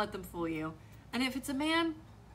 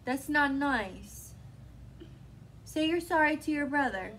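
firm mom; 5 semitones higher than usual. Real, not acted. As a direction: insist firmly like a mom. Mom disciplining toddler.

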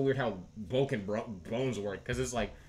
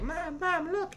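put-on kid calling mom; slower than usual.

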